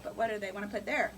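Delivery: ordinary talk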